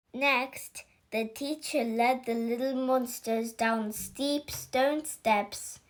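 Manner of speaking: ordinary delivery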